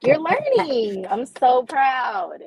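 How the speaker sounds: gushing mock praise. Co-host teasing the host for holding back, gushing mock praise. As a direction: stretch it out like praising a small child.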